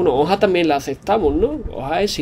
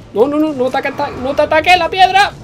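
higher, whimpering plea; a touch of crying panic; fearful pleading; 6 semitones higher than usual. Real, not acted. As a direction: beg the rock not to hit you. Pleading in fear.

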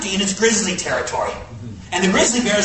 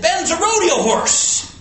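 hollered; 10 semitones higher, slower than usual. Retold or imitated. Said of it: "I hollered to Mel".

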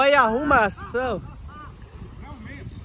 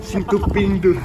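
documentary narrator; 6 semitones lower than usual. Pretend. Mock nature documentary narration.

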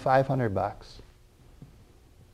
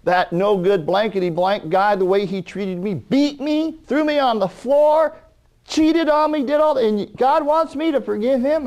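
much higher, rising outrage; angry woman; 10 semitones higher than usual. Retold or imitated. Preacher voices the arthritic woman angry at her husband. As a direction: spit out the injustice, voice climbing. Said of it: "and she got angry".

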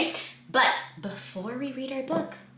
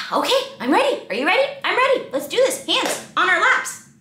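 high, loud eager call; cheerful readiness; excited; 8 semitones higher, 5 dB louder than usual. Acted. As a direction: pump the kids up. Teacher hyping kids for story.

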